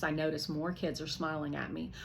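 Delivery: ordinary delivery, nothing marked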